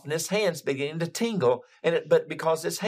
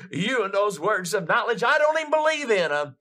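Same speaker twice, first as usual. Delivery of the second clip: high, rising mock outburst; retold indignant protest; exasperated retort; 6 semitones higher, 4 dB louder than usual. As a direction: throw it back in exasperation, climbing high.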